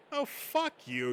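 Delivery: high, slow, offended snap; high-pitched drawn-out outrage; indignant retort. Acted. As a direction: throw it back at him, stung. Friends trading insults over past.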